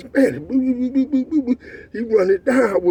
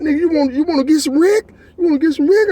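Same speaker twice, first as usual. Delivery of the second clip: taunting challenge; 7 semitones higher, 3 dB louder, faster than usual. Retold or imitated. Challenging a rival to fight.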